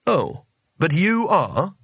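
high, slow, over-polite lilt. A narrator's reading. Rincewind to the locked-in wizards, elaborately polite. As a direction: draw it out with careful courtesy. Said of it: "as politely as possible".

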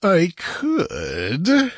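drawn-out, teasing villain, pitch swooping high; gloating assurance. A narrator's reading. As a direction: savour her distress. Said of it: "in evident enjoyment of her distress".

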